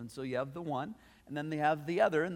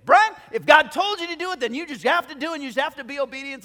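much higher and louder, scolding fast; bossy coworker; 12 semitones higher, 12 dB louder, faster than usual. Retold or imitated. Bookstore gal scolding Brian hands on hips, high loud lecture. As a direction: get in his face and tell him straight. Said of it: "hands on her hips and gets in my face".

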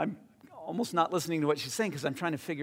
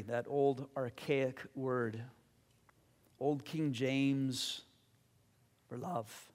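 closing prayer. Acted.

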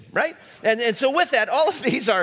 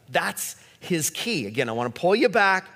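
ordinary delivery

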